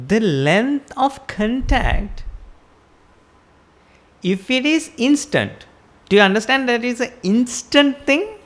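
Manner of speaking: ordinary delivery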